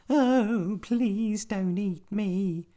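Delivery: very high, slow squeaky plea; pleading spider voice. A narrator's reading. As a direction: beg like a tiny spider. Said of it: "said the spider".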